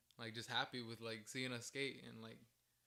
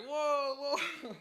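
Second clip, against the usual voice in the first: high, loud double "whoa"; shocked reaction; 14 semitones higher, 14 dB louder than usual. Retold or imitated. Skater mimics friends hearing the proposition, shocked. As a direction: imitate startled friends backing off.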